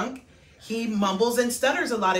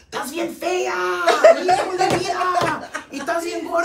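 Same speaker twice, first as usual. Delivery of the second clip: higher, blunt teasing uncle in Spanish; 6 semitones higher, 4 dB louder than usual. Retold or imitated. Imitating her blunt uncle insulting new relatives. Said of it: "he's like".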